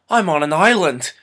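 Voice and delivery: loud, high mock discovery; mocking Pierce Brosnan. Mocking the stranded hero's big discovery, loud and high. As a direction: parody the obvious realization. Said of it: "he goes".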